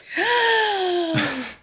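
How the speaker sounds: high theatrical gasp; dramatic gasp. Pretend. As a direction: gasp for a big reveal. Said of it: "so I better quickly do it now".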